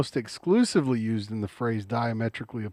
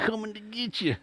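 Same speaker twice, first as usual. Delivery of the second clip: high, softer cartoon prospector; Yosemite Sam voice; 8 semitones higher, 6 dB quieter than usual. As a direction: growl it like a cartoon gunslinger. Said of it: "Yosemite Sam catchphrase".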